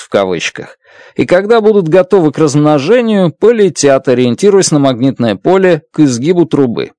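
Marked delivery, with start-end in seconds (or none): none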